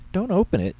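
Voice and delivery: high, loud frightened cry; panicked exclamation. A narrator's reading. Secretary warning the pastor off the cupboard, panicked exclamation. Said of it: "Tammy exclaimed".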